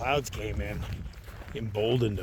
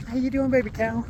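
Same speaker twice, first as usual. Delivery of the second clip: cooing at a calf; 12 semitones higher, 8 dB louder than usual. Real, not acted. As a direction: coo up high to the little cow.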